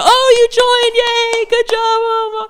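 very high, whooping mock celebration; cheering crowd. Sales room as margaritas flow, high wild cheering. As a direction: shriek congratulations like a party crowd. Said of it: "People are cheering".

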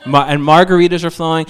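ordinary talk, nothing marked

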